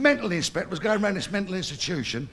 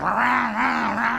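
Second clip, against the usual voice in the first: high, loud engine noise; mock engine revving; 4 semitones higher, 4 dB louder, much slower than usual. Patient on the bed imitating a Ferrari engine. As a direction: rev like a car.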